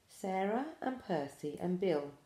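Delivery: ordinary delivery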